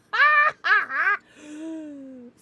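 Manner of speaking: loud laugh breaking into a wail; loud crying laugh. Real, not acted. Streamer laughing at chat, loud crying laugh. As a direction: laugh so hard it nearly cries.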